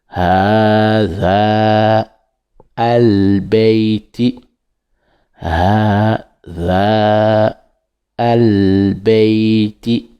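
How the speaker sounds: "ha za al beiti" slowly in separate parts; split apart. Shown as a demonstration. Teacher decomposing the phrase slowly for pupils. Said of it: "si on veut décomposer".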